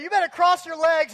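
high, loud drill-sergeant yell; barked order. Retold or imitated. Lifeguard ordering the next kid, loud barking. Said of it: "barking out orders like a drill sergeant".

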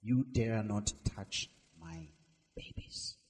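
low, slow protective growl fading to a breath; fierce mother hen. Acted. Hen daring the hawk over her chicks, low and fierce. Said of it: "But she dares the hawk".

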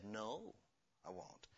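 low, hushed no; quiet refusal. Acted. God refuses Herod's plea to talk, very quietly. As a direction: refuse softly, sinking away.

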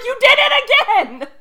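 high, loud laughing accusation; mock outcry. Friend catching her slipping into accent.

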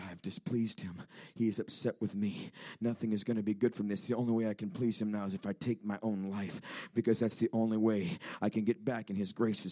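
low, hushed retold despair; quiet despair. Man believing he displeased Allah, quiet despair. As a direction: voice his hopelessness softly and low.

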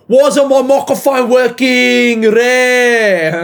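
put-on loud, high Greek-accented yell; hammed-up Greek voice, bellowing in outrage; put-on Greek accent, stretching the last words. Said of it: "Greek voice is coming out".

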